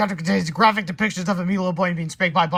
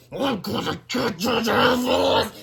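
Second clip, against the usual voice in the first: slow, raised angry threat; furious threat; 6 semitones higher, 3 dB louder, much slower than usual. Acted. Principal screaming at the pranksters. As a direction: vow revenge furiously. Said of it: "Mister Krupp screamed".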